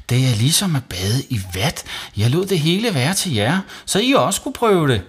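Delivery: high voice climbing with enthusiasm; eager boy. Acted. Savi selling the bath to mum, eager.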